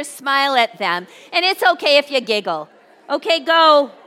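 loud, high rallying voice; calling out instructions. Real, not acted. Getting a giggling audience to practice smiling, loudly calling out. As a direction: project over a laughing crowd.

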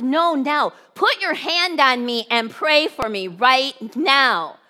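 acted-out impatient insistence; insistent demand. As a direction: press each word as an order. Cashier demands prayer at once.

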